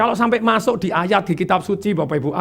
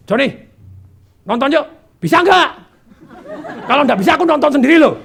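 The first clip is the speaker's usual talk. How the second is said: brisk, louder, higher-pitched blunt invitation; masculine energy; 5 semitones higher, 4 dB louder than usual. Acted. Sister-in-law asking Joni to a film, masculine energy. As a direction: imitate her asking bluntly, like a man. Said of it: "Itu energi laki".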